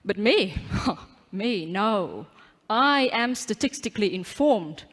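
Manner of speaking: usual speaking voice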